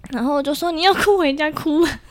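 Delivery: high, loud mock scolding through laughs; laughing scold. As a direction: laugh while bossing him around. (边笑边说哥哥不要哭)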